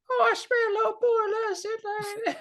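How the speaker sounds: put-on a grown man faking a child to get let in; man at the door faking a little boy, high and whiny; fake little boy voice. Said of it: "a man doing a little boy's voice".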